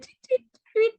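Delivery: high tweeting like a bird; bird sound. Retold or imitated. Mom imitating her son's bird call, high chirps. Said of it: "For the bird".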